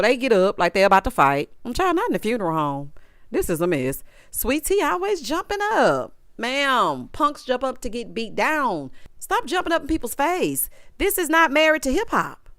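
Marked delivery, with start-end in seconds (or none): none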